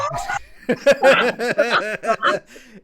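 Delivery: big high burst of laughter; loud laughing fit. Real, not acted. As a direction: crack up loudly at your own story. Laughing at own story.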